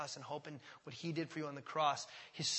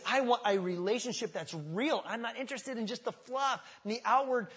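loud, raised, insistent declaration; God's impassioned plea; 5 semitones higher, 7 dB louder than usual. Acted. Preacher speaking as God seeking relationship, impassioned appeal. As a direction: press each want with fervor, easing at the end. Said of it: "The idea is".

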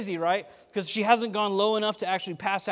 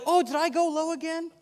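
put-on sweet old lady; 12 semitones higher, 6 dB louder than usual. Old lady offering firefighters a sandwich, sweet and high. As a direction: sound suddenly sweet and grandmotherly. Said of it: "she's like".

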